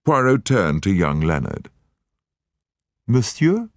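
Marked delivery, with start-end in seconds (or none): 3.1-3.7 a higher Belgian voice with a questioning rise; a lightly voiced detective, inquiring. Poirot turning to young Leonard, inquiring rise.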